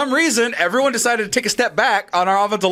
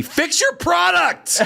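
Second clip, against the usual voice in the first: high, drawn-out exasperated yell; re-enacted irate shout at a bad game; retold TV scream; 11 semitones higher, much slower than usual. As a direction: scream it at the TV, stretching every word. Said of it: "I just screamed at the T V".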